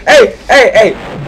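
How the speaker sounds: calling out. Real, not acted. Shouting to get attention.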